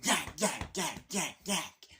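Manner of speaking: short repeated yapping bursts; chatty Cathy doll. Retold or imitated. Man mocks roommate as talking doll, repetitive yapping. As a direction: imitate a pull-string doll jabbering. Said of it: "chatty Cathy doll".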